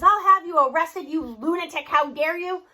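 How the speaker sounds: loud, furious threat; raging shout. A narrator's reading. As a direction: blast him with outrage, full volume. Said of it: "he rages".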